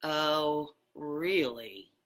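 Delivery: low and falling, unimpressed; low flat oh really. Shown as a demonstration. Speaker demoing tones to his audience, low dismissive. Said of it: "listen to the difference ... in various tones of voice".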